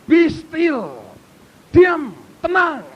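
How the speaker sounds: high, slow preacher's call; commanding proclamation. Real, not acted. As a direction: call it out forcefully, each word stretched.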